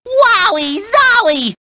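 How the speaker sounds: high, drawn-out cartoon delight; thrilled exclamation. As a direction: burst out in giddy amazement. Thrilled reaction to the song.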